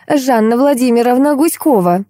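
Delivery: high, self-important introduction; ceremonious. A narrator's reading. Lady introduces herself by full name, ceremoniously. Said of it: "церемонно представилась дама".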